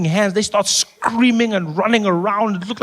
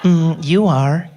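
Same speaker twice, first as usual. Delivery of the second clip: sultry Siri; 3 dB louder, much slower than usual. Retold or imitated. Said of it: "she's got personality".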